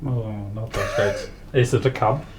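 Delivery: ordinary talk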